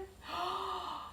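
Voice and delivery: high, soft breathless gasp; stunned gasp. Acted. Brothers hearing it is Joseph, stunned gasp. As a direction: gasp in disbelief.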